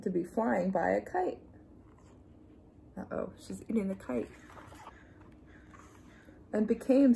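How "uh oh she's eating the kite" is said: a softer, secretive uh-oh; hushed aside. A narrator's reading. Reading to children, hushed worried aside. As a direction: lean in and hush the kids.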